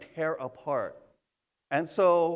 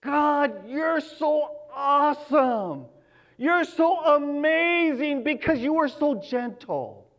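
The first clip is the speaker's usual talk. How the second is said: higher, slow gushing praise; mock-adoring a gentle God; a hint of a gushing believer; imagined worshipper; 8 semitones higher, 4 dB louder, slower than usual. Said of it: "I've ever heard anyone say".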